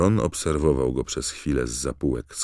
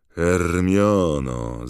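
loud, long drawn-out name; calling out; 3 semitones higher, 6 dB louder, much slower than usual. A narrator's reading. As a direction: stretch the name out in a raised call. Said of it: "zawołał Ron". (głośne, przeciągłe wołanie po imieniu)

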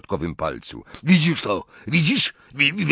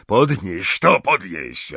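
gruff roar at his daughter, lightly reined in; narrator's moderate roar; roared order; 4 dB louder, slower than usual. A narrator's reading. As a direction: bark it as a rough command. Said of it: "ryknął na nią Gaunt".

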